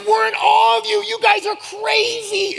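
loud, very high gushing shout; upbeat amazement. Retold or imitated. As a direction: holler it in hammed-up awe, stretching the last words. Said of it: "He was, like, real upbeat".

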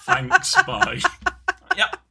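laughing goodbye, very high and bright. Real, not acted. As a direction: sign off through laughter. Laughing, high mock sign-off.

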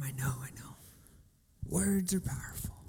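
put-on weary whispered teen reply; mock whisper. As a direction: sigh it out under your breath. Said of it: "Where you're like".

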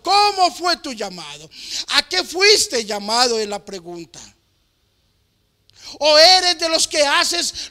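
fervent preaching. Acted. Preacher urging believers that they are different, fervent and loud. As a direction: drive it home to the congregation.

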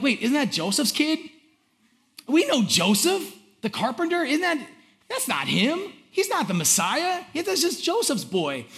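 high-pitched, acted disbelief; incredulous townsfolk. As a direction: question it as a doubting neighbor. Acted townsfolk doubting the Messiah.

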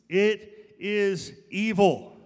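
slow and loud, "evil" drawn out; thundering preaching. Real, not acted. Pastor hammering his point to church, slow loud thunder. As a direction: drive it home slowly.

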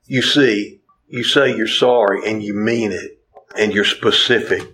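usual speaking voice

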